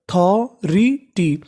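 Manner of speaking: Authority stretched out very slowly, syllable by syllable; slow teaching demo. Shown as a demonstration. Teacher to students, slowly sounding out the word. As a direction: spell authority out slowly, each syllable apart. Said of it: "तो meaning क्या बनी?".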